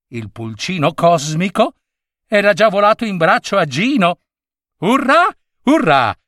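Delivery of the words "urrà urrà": cheering. Acted. Chick celebrates mission accomplished.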